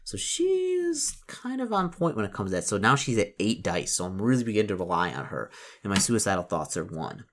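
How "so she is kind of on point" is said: high squeaky aside. Acted.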